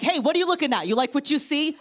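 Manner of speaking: snapping at a staring stranger, playfully; mock confrontation. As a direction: taunt the gawker, chest out. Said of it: "I'm like".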